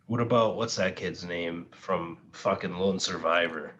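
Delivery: ordinary talk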